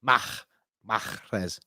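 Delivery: Mahrez in two goes, "makh", then "MAKH-rez"; sounded H. Shown as a demonstration. Host practising the H, throaty pronunciation. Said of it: "supposed to pronounce the H".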